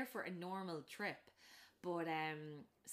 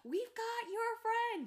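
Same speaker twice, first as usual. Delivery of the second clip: much higher, loud, slow call; hillbilly calling out to the kids; calling across water; 8 dB louder, much slower than usual. Retold or imitated. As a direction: shout it across the lake.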